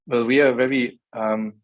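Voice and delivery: normal talking voice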